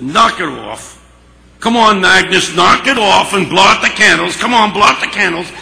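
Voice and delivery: gruff urging. Retold or imitated. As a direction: push her loudly to get on with it. Harry gruffly urges crying Agnes.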